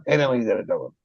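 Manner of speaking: normal talking voice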